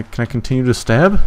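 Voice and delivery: ordinary talk, nothing marked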